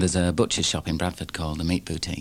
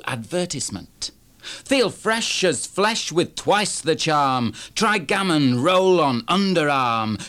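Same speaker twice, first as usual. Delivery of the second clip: higher, rapid-fire rhythmic performance voice; poem recitation; 9 semitones higher, 4 dB louder, slower than usual. Acted. Poet performing Meat Boutique to the audience, higher and rhythmic. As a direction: rattle the rhymes off like a live act.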